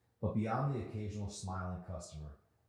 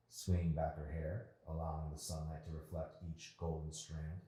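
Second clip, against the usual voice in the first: ordinary delivery, nothing marked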